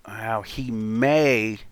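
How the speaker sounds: ordinary delivery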